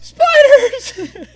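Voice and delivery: high, loud yell of panic; panicked yell. Retold or imitated. Buddy freaking out as spiders cover the wall, loud high yell. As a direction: scream the word like you just saw them. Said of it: "he's freaking out".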